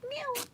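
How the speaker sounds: high cooing meow to a cat. Real, not acted. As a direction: meow sweetly at the kitten. Meowing at the cat.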